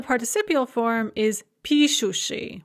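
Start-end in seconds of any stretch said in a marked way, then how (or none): 1.6-2.6 пишущий accented on its opening пи; stress on first syllable. Shown as a demonstration. Teacher gives the participial form пишущий, first syllable stressed. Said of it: "with the stress on the first syllable".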